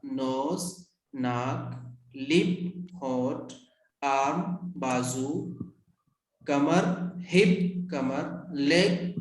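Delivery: slow, low word-by-word drill; measured dictation with pauses between words; model pronunciation. Shown as a demonstration. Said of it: "एक मरतबा और सुन लीजिए".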